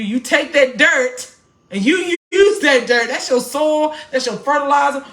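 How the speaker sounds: lifting the church with each phrase; rising preacher fervor. Real, not acted. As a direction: preach it out to the congregation, building.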